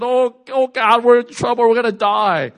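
mock panic, voice up high; panicked servant. Servant running to Elisha in terror, panicked wail. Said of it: "starts shaking his pants".

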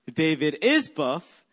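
slow phrase with "is" punched hard; stress on IS. Shown as a demonstration. Pastor showing congregation the syllable game, stress on "is". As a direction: hit the word "is". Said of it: "you emphasize every syllable".

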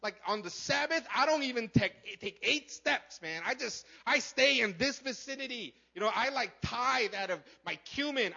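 high, loud, indignant mock rant; pissed-off Pharisee. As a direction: rant in self-righteous outrage, hammering key words. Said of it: "this pissed him off".

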